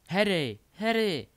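high, loud cries of a name; desperate calling out. Acted. Hermiona calls Harry through locked doors, desperate shout. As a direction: call out desperately, rising on the second. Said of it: "volala Hermiona a zoufale bušila do dveří".

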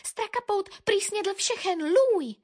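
high, small bird voice; peeping little bird. A narrator's reading. Little tit reports to the dog, high peep. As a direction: peep it out, pitched right up. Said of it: "pípla Modřinka".

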